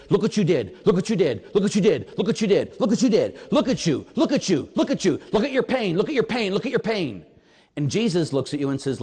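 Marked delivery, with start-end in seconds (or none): 0.1-7.2 rapid-fire repeated jabs in a raised voice; needling, poking devil. Acted. Devil accusing a guilt-ridden man, relentless needling. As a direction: poke at him relentlessly, each phrase the same jab. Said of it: "he kept poking and poking and poking".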